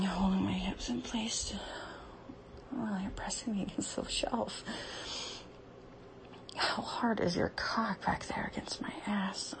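hushed, aroused whisper. Real, not acted. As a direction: keep it breathy and secret. Whispering while being touched.

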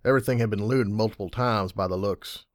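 ordinary delivery

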